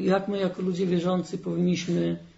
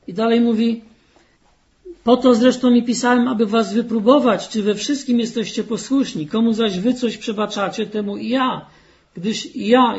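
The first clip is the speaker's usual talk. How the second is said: louder emphatic reading of the letter; forceful preaching; 3 semitones higher, 6 dB louder than usual. Acted. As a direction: press the apostle's words on them. Reads Paul's letter forcefully. (mocne kaznodziejskie czytanie)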